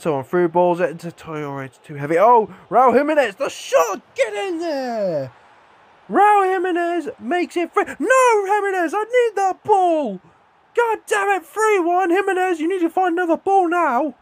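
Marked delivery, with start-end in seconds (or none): 2.2-5.3 excited cheer. Real, not acted. His striker shoots and scores. 6.1-14.1 frantic pleading. Real, not acted. Pleading with his striker after a miss.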